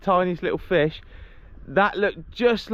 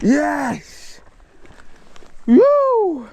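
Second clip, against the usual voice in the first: high thrilled whoop; excited shout; 11 semitones higher, 6 dB louder than usual. Real, not acted. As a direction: cheer the catch loudly. Landing the bass.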